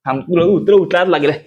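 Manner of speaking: ordinary talk, nothing marked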